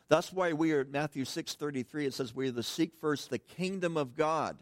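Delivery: loud, fervent preaching. Acted. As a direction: proclaim it with full force. Preaching at full force.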